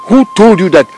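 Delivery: high, loud rebuke; angry challenge. Acted. Elder scolding over Malaysia venture, angry challenge. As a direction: throw the question out sharply.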